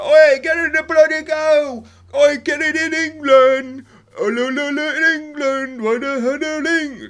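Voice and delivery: high, loud, slow bellowed chant at the TV; hammed-up British football lad shouting at the screen; football fan yelling. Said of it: "staring at the T V like".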